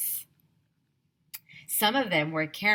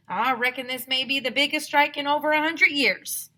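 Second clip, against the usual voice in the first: put-on old prospector; 7 semitones higher, 6 dB louder than usual. A narrator's reading.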